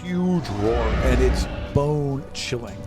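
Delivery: ordinary talk, nothing marked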